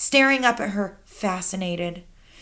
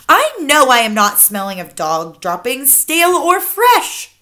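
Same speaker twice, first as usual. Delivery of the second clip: loud protest rising higher at the end; indignant cry; 5 semitones higher, 9 dB louder than usual. A narrator's reading. Child insisting he doesn't smell, crying out. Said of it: "I cried".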